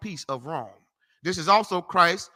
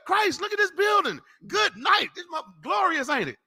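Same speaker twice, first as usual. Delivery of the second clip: high-pitched excited awe; acted-out giddy amazement, pitched way up; excited disciples; 10 semitones higher than usual. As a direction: gush like thrilled onlookers showing off a wonder. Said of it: "They all excited".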